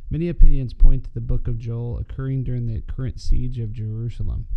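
usual speaking voice

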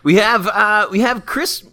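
hammed-up host announcing a caller; hesitate, then stretch out the name; radio host. Host introduces next caller.

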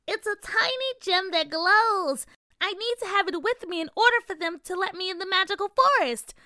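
high fairy queen counting up; fairy voice building to a cry; cold regal fairy queen. A narrator's reading.

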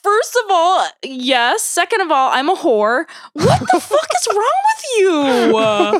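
high, indignant comeback, played for laughs; hammed-up exasperated telling-off; mock outrage. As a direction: start emphatic, end in a drawn-out high wail. Retold indignant comeback, acted out.

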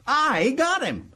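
high, slow crow with a long I; slow, high-pitched boast; savoring triumph. Acted. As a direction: stretch it out and relish the catch. Repeats the boast, drawn out.

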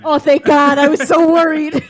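loud, high burst of relief with laughter; loud mock relief. Relieved at not mixing up the actor, loud mock relief. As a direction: cry out in overblown relief. Exaggerated relief at being right.

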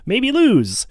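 loud, high hammed-up sportscaster; sports commentator. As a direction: parody a game-day pundit.

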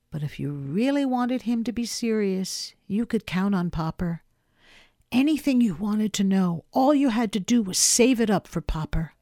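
ordinary delivery

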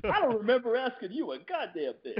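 high, laughing movie quote; mock tough-guy line. Quoting a tough movie line to the grilled guest, laughing. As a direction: fire back the movie line through a laugh.

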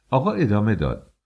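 usual speaking voice

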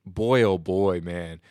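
ordinary talk, nothing marked

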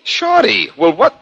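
startled high exclamation; surprised. Acted. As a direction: caught off guard, pitch jumps. Surprised at friend at gunpoint.

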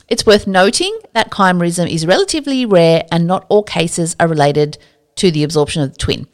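ordinary talk

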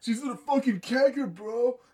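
loud, high joking outburst; mock shout. Co-host joking about where she is, mock shout. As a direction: shout it out like a rowdy bro.

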